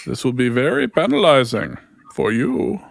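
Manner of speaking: slow, put-on Indian accent; a clumsy Indian accent impression; bad Indian accent. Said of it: "weirdly close to a bad Indian accent".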